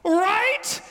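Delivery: very high, loud comic squeal; mock outraged spouse. To a spouse in the car after dinner, high-pitched disbelief.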